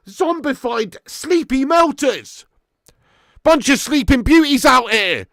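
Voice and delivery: scornful jibe. Real, not acted. Mocking sluggish players.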